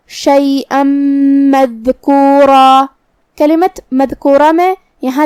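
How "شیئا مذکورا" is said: شیئا مذکورا chanted slowly, tanween melting into a long hummed m; merging with ghunna. Shown as a demonstration. Teacher showing how to merge into meem, slow hummed chant. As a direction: hold the nasal m joining شیئا to مذکورا. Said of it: "یہ حکم کو ایسے ادا کریں گے".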